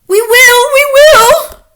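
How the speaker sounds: high, loud eager witch chorus; high loud witch answer; witches answer. A narrator's reading. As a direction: chime in eagerly like fawning witches. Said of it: "they answered".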